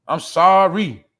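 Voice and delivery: a hammed-up shout of an apology; mock loud apology. Shouting a fake sorry at the viewers, hammed-up and loud.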